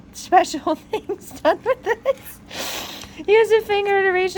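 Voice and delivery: high, slow words through laughter; giggling. Real, not acted. Vlogger amused by the truck manual's innuendo, giggling. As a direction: barely get it out for laughing.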